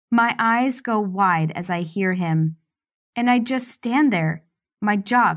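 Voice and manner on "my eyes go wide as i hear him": plain reading voice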